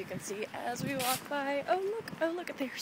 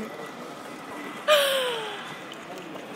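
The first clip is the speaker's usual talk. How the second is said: delighted gasp; 11 semitones higher than usual. Real, not acted. Finding a bottle of water.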